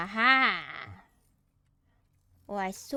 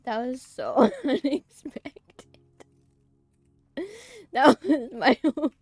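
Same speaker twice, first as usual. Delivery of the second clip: laughing; 5 semitones higher, 9 dB louder than usual. Real, not acted. Still laughing after the yell.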